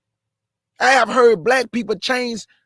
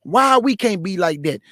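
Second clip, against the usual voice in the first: ordinary talk, nothing marked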